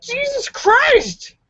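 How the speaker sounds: high, slow startled yelp; mock shout. April jabbed awake in class, high slow startled yelp. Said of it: "April jumped up and shouted".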